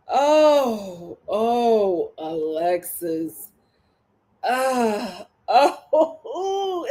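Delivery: hammed-up lament wail; mock wailing Alexis; mock crying. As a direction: moan her name in mock grief. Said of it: "mock crying over Alexis".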